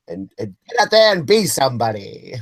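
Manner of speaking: put-on old-time announcer voice; vintage newsreel announcer; 1930s news announcer. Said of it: "Who are you sliding into?".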